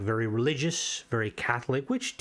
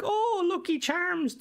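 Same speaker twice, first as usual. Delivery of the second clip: high, slow mocking Oirish cliché; sing-song mockery of the Lucky Charms cliché; slower than usual. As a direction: lampoon how outsiders picture the Irish. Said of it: "kind of the, like".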